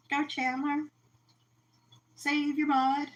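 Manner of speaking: ordinary talk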